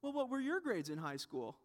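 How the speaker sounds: imitated offhand small talk; subtle brag. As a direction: pretend idle curiosity to set up a boast. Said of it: "we're not quite that subtle".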